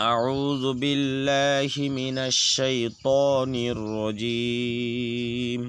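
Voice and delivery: slow, measured tajweed chant; slow Quranic chant opening the lesson; Quran recitation. Acted. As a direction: recite it slowly and reverently, every vowel drawn out.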